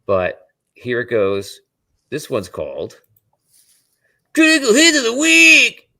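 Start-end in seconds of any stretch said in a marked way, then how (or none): 4.3-5.9 high, loud show announcer call; hype announcer. Acted. Announces the segment title.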